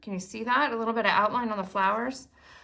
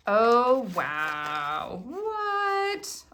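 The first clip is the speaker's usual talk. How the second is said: surprised gasp; much slower than usual. Real, not acted. Surprise at the die cuts.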